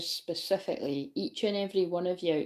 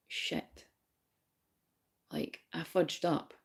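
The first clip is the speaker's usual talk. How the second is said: hushed realisation; 2 semitones lower, 10 dB quieter, slower than usual. Acted. Voicing someone realising they messed up, hushed. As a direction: admit it under your breath, acting it out.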